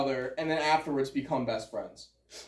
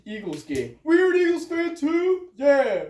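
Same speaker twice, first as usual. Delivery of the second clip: gym bro; 10 semitones higher, 5 dB louder than usual. Pretend. Acting out the wounded duelists.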